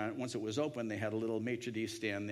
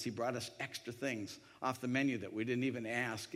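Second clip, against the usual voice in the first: ordinary talk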